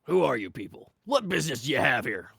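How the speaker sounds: loud, stern guard voice; shouted challenge. Acted. Snake-headed guard challenging strangers at the gate, shouted. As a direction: demand it forcefully as a guard. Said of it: "shouted Snakeface".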